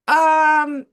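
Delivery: high, loud, drawn-out hesitation; awkward stalling. Retold or imitated. Waxer asked out for the weekend, high awkward stalling. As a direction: hold one long high filler sound.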